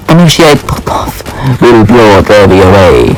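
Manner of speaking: same henchman voice. Acted. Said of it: "I just did the same dang voice".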